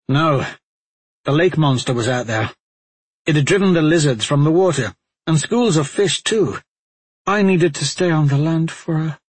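lightly voiced flustered warrior; hurried excuse, trailing off; defensive Talmadge.

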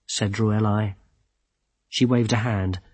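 usual speaking voice

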